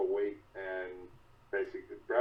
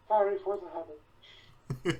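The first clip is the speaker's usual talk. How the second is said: Kiwi voice; 10 semitones higher, much slower than usual. Acted. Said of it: "I can hear Kiwi".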